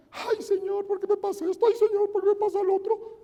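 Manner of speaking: high-pitched whiny plea to the Lord; mock tearful wailing. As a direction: wail it like a hammed-up complainer at the altar. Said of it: "se ponen a llorar en el altar".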